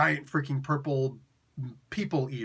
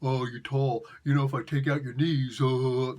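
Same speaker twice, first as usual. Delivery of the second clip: low mocking voice of a would-be tough guy; lowered, mocking playground taunt; taunting short guy; 5 semitones lower than usual. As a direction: imitate a dim, belligerent heckler. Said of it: "what do they always say?".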